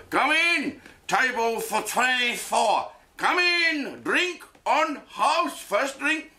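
put-on high, slow waiter accent, overly eager; mock Indian waiter. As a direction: welcome the drunk lads in a put-on accent. Indian waiter welcoming drunk customers.

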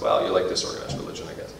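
quick, hushed dry retort; sarcastic aside. Pretend. Preacher mocking critic, quick hushed sarcastic aside. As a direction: mutter it back with mock innocence. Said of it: "It's like".

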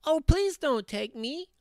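tiny, very high pleading goat; very small voice. A narrator's reading. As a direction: plead as the littlest billy goat. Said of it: "in his very small voice".

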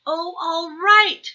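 little owl loudly relenting; exasperated cry. A narrator's reading. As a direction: cry out in frustrated surrender. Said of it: "cried Baby Owl".

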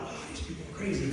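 fast, soft mutter to himself; quiet mock aside; muttered thought. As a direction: mutter it under the breath.

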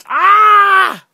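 high, loud "ah" of frustration; wordless cry of exasperation; exasperated yell. Real, not acted. Reviewer fed up with the actor, exasperated yell.

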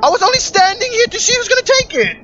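high indignant shout; outraged yell. Real, not acted. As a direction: yell it, betrayed and shocked. Shot by teammate, outraged.

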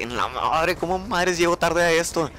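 drawn-out, rising mock dismay; retold dismay. Latecomer reacting to a class already under way, retold dismay. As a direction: stretch it out, pitching up in alarm.

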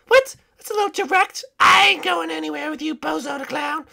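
high-pitched princess refusal; high cartoon princess, scornful; affronted princess. Princesses rebuff Ice King.

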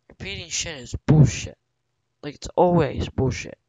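loud, furious complaint; angry rant. Real, not acted. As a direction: spit it out, fed up with the game. Gamer ranting about repeating levels.

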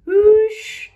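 high loud whoosh; storybook wind noise, high and loud. Acted. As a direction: blow it out like a gust. Wind on Saturn.

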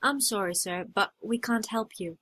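ordinary talk

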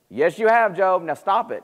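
acted finger-wagging rebuke; scolding retort. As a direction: cut off his denial as an exasperated friend. Friends retort to Job's denial.

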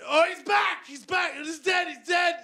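put-on high, shouting excited kid; a toddler yelling as a parent comes and goes; frantic toddler. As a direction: swing wildly between joy and panic.

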